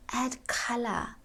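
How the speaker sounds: slow, hushed whisper; whispered for color. Shown as a demonstration. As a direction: draw it out in a soft whisper. Shows vocal color while advising it.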